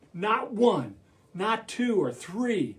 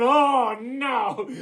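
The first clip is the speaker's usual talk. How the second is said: animals' dismay; 4 semitones higher, 3 dB louder than usual. Acted. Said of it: "all the animals going".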